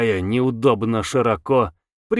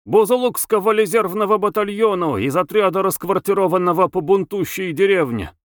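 high-pitched soldier's patter; rattled-off report; 7 semitones higher than usual. A narrator's reading. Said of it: "скороговоркой рапортовал рослый солдат".

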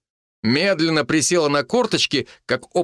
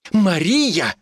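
high, indignant cry of the name; indignant outburst; 7 semitones higher than usual. A narrator's reading. Mitka protests to the village healer, indignant. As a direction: flare up in offence, pitch raised. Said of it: "взвился Митька".

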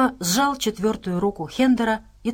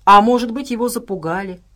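plain reading voice

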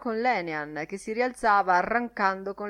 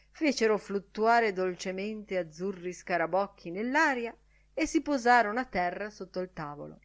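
plain reading voice

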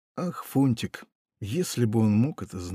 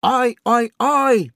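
high, louder parrot squawk; 11 semitones higher, 5 dB louder, much slower than usual. A narrator's reading. Parrot squawks alarm over the pirate's talk. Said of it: "подал голос попугай".

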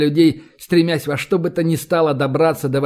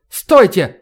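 louder, higher cry to halt; shouted; 4 semitones higher, 6 dB louder than usual. A narrator's reading. Professor trying to halt the squad, urgent shout. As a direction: call out to stop them. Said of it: "крикнул он".

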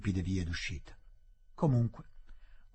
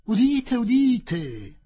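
high, loud approving cries of hear hear; a club crowd calling out its approval; voices cheering; 12 semitones higher, 9 dB louder, much slower than usual. Acted. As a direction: members loudly applauding his frankness. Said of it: "dissero alcune voci".

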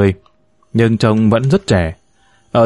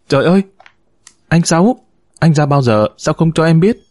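higher, surprised cry of recognition; astonished exclamation; 6 semitones higher than usual. A narrator's reading. Nguyệt recognizes Sáu and cries out, higher and astonished. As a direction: call out in shocked delight. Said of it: "Nguyệt sừng sốt kêu lên".

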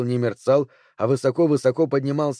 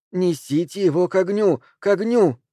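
high, insistent cry; mounting, high-pitched order; urgent command; 6 semitones higher than usual. A narrator's reading. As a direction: drive the bearers on, pitch climbing. Figure repeats urgent order.

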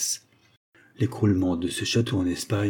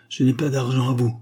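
ordinary delivery

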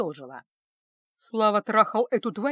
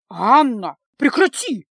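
outraged cry; 8 dB louder, slower than usual. A narrator's reading. Mother crying out at her daughter, loud and scandalized. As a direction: cry out to stop her, voice jumping up. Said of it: "Новый вскрик от матери".

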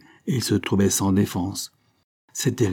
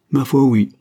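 higher, louder cheerful yes; joyful; 5 semitones higher, 7 dB louder than usual. A narrator's reading. Young heir confirming he is now rich, joyfully. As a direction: answer with happy assurance. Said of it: "fit joyeusement Prosper".

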